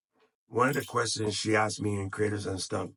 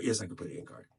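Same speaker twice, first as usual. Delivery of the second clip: firm answer, lower and softer; self-assured answer; 4 semitones lower, 4 dB quieter, much faster than usual. Real, not acted. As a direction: settle it for yourself, falling at the end. Answers his own question.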